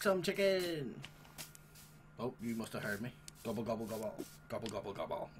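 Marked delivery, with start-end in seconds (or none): 3.4-5.3 low, soft gobbling like a turkey; turkey imitation. Player mocking a chicken, low gobbling turkey voice.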